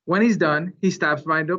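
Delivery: plain reading voice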